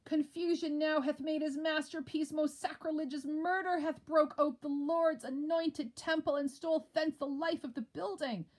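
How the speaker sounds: high, loud solemn report; grave announcement. Acted. Macduff announcing the king's murder.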